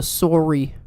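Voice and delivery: imitated Canadian "sorry"; Canadian accent. Joking about the funniest Canadian word, Canadian accent. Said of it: "funniest thing about the Canadian accent".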